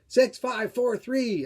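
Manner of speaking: high, loud party countdown; countdown shout. Acted. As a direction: call out the numbers like a crowd at midnight.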